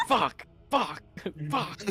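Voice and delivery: high-pitched, comic exasperation; mock frustration. Gnome losing at Pong to his own consciousness, mock frustration.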